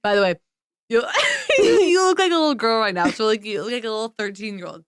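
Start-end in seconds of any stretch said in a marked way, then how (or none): 1.6-3.1 laughing tease. Real, not acted. Laughing at friend's face.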